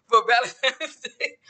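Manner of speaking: laughing through the correction; amused at her own mix-up, said slowly with a laugh. Real, not acted. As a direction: chuckle as you fix the slip.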